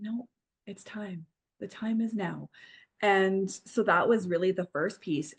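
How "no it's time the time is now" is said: quiet inner voice. Retold or imitated. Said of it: "that voice inside that was saying".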